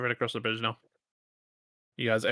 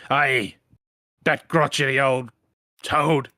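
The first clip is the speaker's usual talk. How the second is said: loud grumbling farmer; 6 dB louder, slower than usual. Acted.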